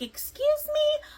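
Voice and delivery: very high, slow, offended mock outrage; shocked excuse me. Server insulted on the porch, shocked and offended. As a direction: stretch it out in affronted disbelief.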